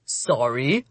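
low, soft mocking question with a late rise. A narrator's reading. Demon pretends not to hear her plea, mocking. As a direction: feign not hearing, low and quiet. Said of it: "The Oni's voice was mocking".

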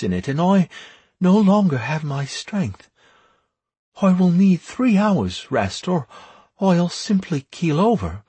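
high-voiced priestess owning her exhaustion; drained priestess. A narrator's reading. Priestess explains she needs rest, high-voiced and drained.

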